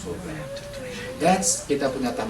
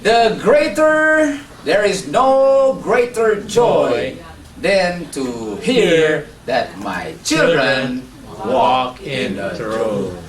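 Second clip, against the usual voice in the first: slow, loud, soaring preacher voice; preaching at full force; 3 semitones higher, 3 dB louder, much slower than usual. Acted. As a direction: proclaim it slowly to the congregation. Preacher proclaims the closing verse. (berkhotbah dengan lantang dan pelan)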